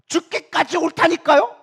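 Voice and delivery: high, loud, drawn-out insistence; acted-out indignant protest; defiant arguing. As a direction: argue back stubbornly, stretching the words. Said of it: "막 이렇게 따지고 있는 거예요".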